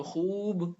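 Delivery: "خوب" with a long "oo", khuub; maruf waw. Shown as a demonstration. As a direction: stretch the vowel of "خوب" as khuub. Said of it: "معروف پڑھا جائے گا".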